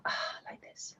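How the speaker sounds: breathy whisper as she sinks back; soft whispered settling sigh. Shown as a demonstration. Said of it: "I'm just gonna take a seat back as well".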